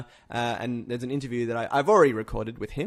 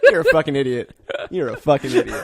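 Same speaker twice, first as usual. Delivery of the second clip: loud laughing jab at a friend; laughing insult; 7 dB louder than usual. Real, not acted. Host mocking his co-host's pick, laughing and loud. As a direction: tease him through laughter.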